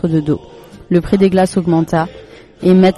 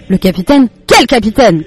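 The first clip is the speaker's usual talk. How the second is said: louder, startled questioning; alarmed cry; 3 semitones higher, 5 dB louder than usual. A narrator's reading. Father startled at mention of the captain, raised alarmed voice. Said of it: "cria son papa".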